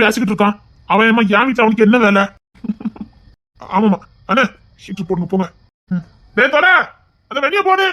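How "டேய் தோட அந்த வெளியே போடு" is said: hollered order. Acted. Drunk man calls out an order.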